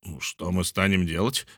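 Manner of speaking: ordinary delivery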